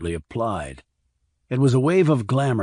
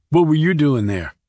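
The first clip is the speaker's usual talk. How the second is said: louder, higher retort; shot back; 5 semitones higher, 7 dB louder than usual. A narrator's reading. Randy shoots back at his sister, louder and higher. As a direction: snap it right back at her. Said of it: "he shot back".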